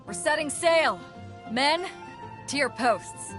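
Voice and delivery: rally the crew with a lifted voice; rallying call. Acted. Captain sending the men to their posts, rallying call.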